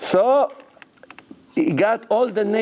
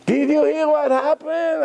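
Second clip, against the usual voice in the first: near tears; 11 semitones higher than usual. Retold or imitated. Broker repeating the news, high upset voice. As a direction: ask it like a frantic broker. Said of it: "She's almost crying".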